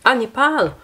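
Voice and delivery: sudden high exclaim of recognition; light up, it's suddenly obvious; surprised recognition. Real, not acted. Streamer spotting the Nepal costume, surprised high exclaim.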